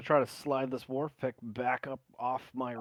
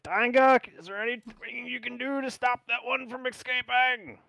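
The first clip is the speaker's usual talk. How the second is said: loud, high yell to someone far off; 12 semitones higher, 8 dB louder than usual. Acted. Player calls to an ally to stop the escapee, yelling. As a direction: holler it urgently across the battle. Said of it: "I'm gonna yell".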